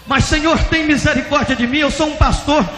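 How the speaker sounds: high, loud begging; desperate pleading. Retold or imitated. Pastor begging God for mercy, loud desperate plea. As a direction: cry out for mercy.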